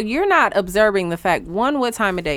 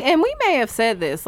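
ordinary talk, nothing marked

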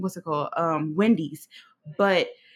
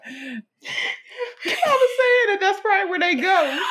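laughing; 11 semitones higher than usual. Real, not acted. Host laughing while explaining where they go, laughing hard.

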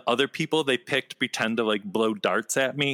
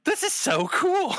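very high, giddy squeal of awe. Retold or imitated. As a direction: gush like a thrilled kid. Retold, much higher, far.

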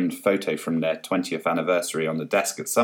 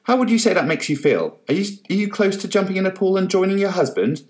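pushy reporter; 11 semitones higher, 3 dB louder than usual. Acted.

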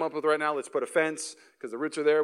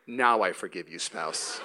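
lower, grand, regal grant of forgiveness; hammed-up spouse graciously pardoning; mock lofty pardon; 9 semitones lower, slower than usual. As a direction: bestow it like a magistrate.

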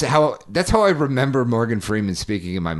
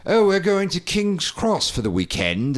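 higher, lilting mock British accent; light mock British accent; 7 semitones higher than usual. Shown as a demonstration. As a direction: put on a posh British accent for the line. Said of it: "it's like".

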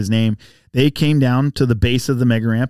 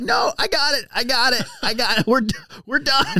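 hurt host's voice; 12 semitones higher than usual. Retold or imitated. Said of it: "he was like".

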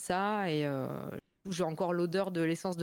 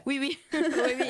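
eager yes; 6 semitones higher, 5 dB louder than usual. Real, not acted. Answering eagerly with Lorrain oui.

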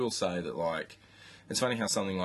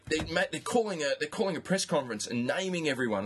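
higher, hurried and insistent; higher voice, mock-worried; mock panicked insistence; 8 semitones higher, faster than usual. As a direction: parrot a worked-up mate who swears it's true. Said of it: "He's like".

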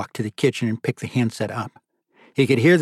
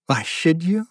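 higher, rising disbelief on "you"; incredulous; 5 semitones higher, slower than usual. Acted. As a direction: throw the question back in disbelief. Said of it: "Marty replied incredulously".

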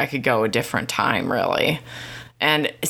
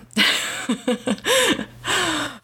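rueful laugh; 11 semitones higher than usual. Real, not acted. Laughs off rant.

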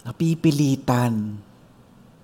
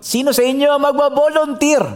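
higher, teasing challenge; millionaire challenging; 11 semitones higher, 3 dB louder than usual. Retold or imitated. Millionaire dares the bachelors to volunteer, teasing. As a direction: dare the crowd to step up.

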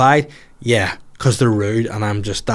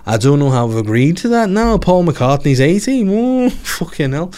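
louder, higher put-on Liverpool voice; 7 semitones higher, 4 dB louder than usual. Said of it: "sound like the Beatles".

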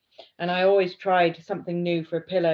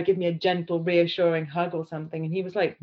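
normal talking voice

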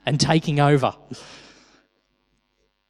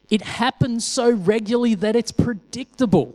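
raised pitch; 8 semitones higher than usual. Acted. Preacher stressing the verse to his congregation, pitched high. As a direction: lift the whole line high, almost sing-song.

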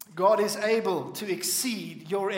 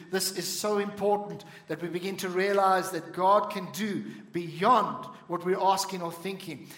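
ordinary talk

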